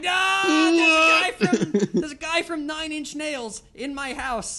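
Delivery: high, slow, laughing amazement; laughing disbelief. Retold or imitated. Telling friends a Nine Inch Nails guy is home, high laughing disbelief. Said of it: "And I'm like".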